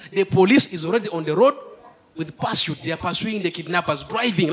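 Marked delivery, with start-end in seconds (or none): none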